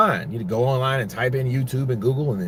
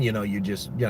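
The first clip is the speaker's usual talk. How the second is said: ordinary talk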